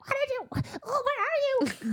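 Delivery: very high, eerie creature voice; Weaver voice. Retold or imitated. Blind Weaver hunting the intruder, high creature voice. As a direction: put on a high-pitched blind monster. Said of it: "the Weaver's like".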